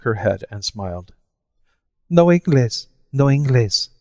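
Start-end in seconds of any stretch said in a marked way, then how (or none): 2.1-2.9 put-on high, lisping Spanish-accented No Inglés, said noh een-GLAYS; lisped foreign English. A narrator's reading. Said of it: "she lisped". 3.1-3.9 lisped foreign English. A narrator's reading. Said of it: "she lisped".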